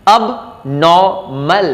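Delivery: "abnormal" drawn out as ub... naw... mul; syllable by syllable. Shown as a demonstration. Teacher breaking it up for learners, "abnormal" very slowly in pieces. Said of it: "this is abnormal".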